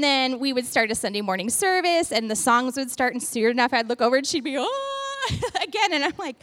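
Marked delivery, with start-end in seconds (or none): 4.6-5.3 high mock wail; exaggerated "oh" of someone bursting into tears; imitated crying. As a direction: put on a high, drawn-out sob. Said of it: "like, not pretty cry".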